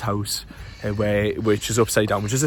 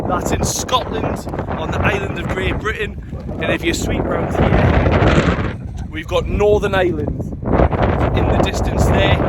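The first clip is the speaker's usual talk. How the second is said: high raised voice in the wind; shouting over wind; 8 semitones higher than usual. Real, not acted. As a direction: project over the gusts. Windy clifftop.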